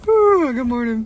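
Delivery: mock casual greeting. Mocking a character's unbothered reaction, breezy and loud. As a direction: chirp it like nothing happened. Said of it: "he's like".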